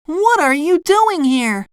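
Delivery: high, astonished cartoon voice; SpongeBob crying out. A narrator's reading. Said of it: "he cried".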